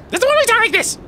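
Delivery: put-on very high falsetto girl voice; girl impression. Shown as a demonstration. Showing friends his girl impressions, very high falsetto. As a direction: squeak it up like a girly lady. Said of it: "these are my three girl impressions".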